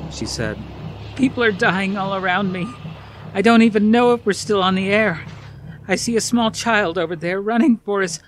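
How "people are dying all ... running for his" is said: high, loud, frightened broadcast; desperate report. Acted. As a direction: keep talking though terror rises. Reporter reporting amid dying people.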